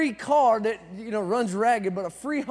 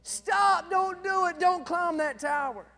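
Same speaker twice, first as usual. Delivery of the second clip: high mock scream; 6 semitones higher, 4 dB quieter, slower than usual. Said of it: "they're all sitting there screaming".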